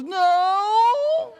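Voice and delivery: high, loud wailing no; crying child's no. Retold or imitated. Son wailing his answer to his mother. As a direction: wail it like a sobbing kid.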